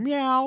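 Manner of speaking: high, playful meow like a cat; mock cat meow. A mate imitating people meowing at him, high. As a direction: mimic a cat meowing at passers-by. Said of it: "people going".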